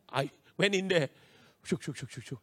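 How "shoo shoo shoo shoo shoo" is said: low, soft "shook shook" noises; imitated swishing. Learner recounting the drive through the test, soft swishing. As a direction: make quick little manoeuvring sounds.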